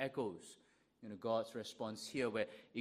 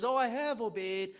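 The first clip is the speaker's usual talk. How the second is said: mock protest; 10 semitones higher, 8 dB louder than usual. Saul's repeated claim mimicked.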